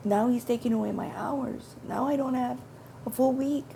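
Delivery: crying out to Father, scared and confused; tearful prayer. Retold or imitated. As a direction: pour out your worry to God near tears. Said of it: "And I'm starting to cry".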